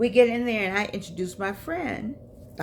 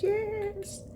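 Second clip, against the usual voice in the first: sweet eager yes; 13 semitones higher, 4 dB quieter than usual. Retold or imitated. Rosalind eagerly accepting.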